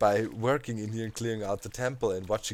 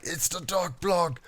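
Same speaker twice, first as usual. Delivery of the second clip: higher and slow, thrilled to announce it; excited reply; 6 semitones higher, slower than usual. Retold or imitated. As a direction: spell it out slowly, barely holding in the joy. Telling wife the package came.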